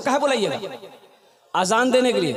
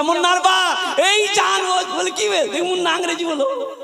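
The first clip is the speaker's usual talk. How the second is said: excited wife calling; 8 semitones higher than usual. Retold or imitated. Mother excitedly calls her husband.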